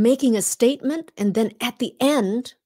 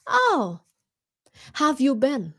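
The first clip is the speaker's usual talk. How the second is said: pleased high Oh, then how have you been; friendly greeting; 3 semitones higher than usual. Shown as a demonstration. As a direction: greet a returning customer warmly.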